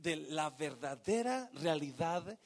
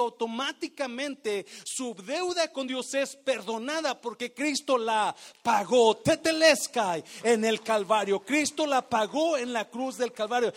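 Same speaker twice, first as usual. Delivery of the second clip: loud, driving sermon voice; fervent pulpit delivery; full-force preaching; 6 semitones higher, 8 dB louder than usual. Acted. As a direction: push the message hard to the congregation.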